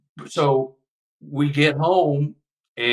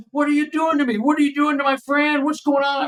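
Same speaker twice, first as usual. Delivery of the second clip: much higher, panicky cry with little extra volume; mock terror, pitched way up; retold panicked scream. Under hypnosis, reliving a scare, much higher frightened cry. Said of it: "I'm screaming".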